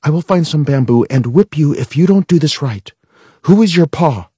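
loud, harsh threat; mother acting a soldier, loud threat; threatening soldier. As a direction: menace with the rifle. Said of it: "imagine my finger as a rifle".

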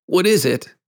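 put-on quick, high question from a frightened boy; pained cry. A narrator's reading. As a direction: cry out in alarm. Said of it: "he cried again".

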